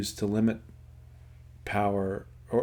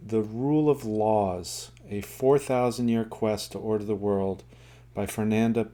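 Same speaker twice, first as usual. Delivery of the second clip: ordinary delivery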